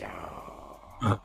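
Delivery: low, soft mock lament; mock whine. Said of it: "He's like".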